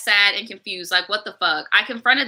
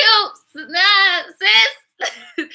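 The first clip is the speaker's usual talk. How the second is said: high incredulous nah; 10 semitones higher, much slower than usual. Real, not acted. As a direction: squeal nah sis up high in disbelief. Disbelief at boyfriend photo.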